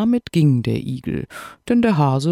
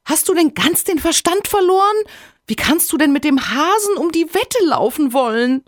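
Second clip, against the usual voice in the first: shrill-high, louder shocked cry; screaming wife; 4 dB louder than usual. A narrator's reading. Hedgehog's wife hears about the bet, screaming. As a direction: wail at him in horror. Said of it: "fing nun des Igels Frau an zu schreien".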